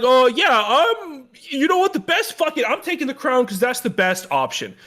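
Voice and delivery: high, exaggerated mocking quote; mock turnaround. Mocking a character's sudden turnaround.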